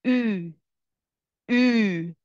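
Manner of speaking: او as a long "ü", from the front of the mouth; isolated او as ü, modelled twice; front rounded ü. Shown as a demonstration. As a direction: pucker and push او forward as ü.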